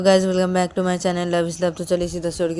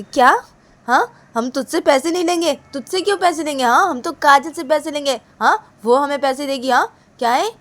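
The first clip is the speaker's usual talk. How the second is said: raised, loud objection; indignant insistence; 8 semitones higher, 4 dB louder than usual. Acted. Reshma's cousin insisting only Kajal should pay, indignant. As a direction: protest firmly in a higher voice.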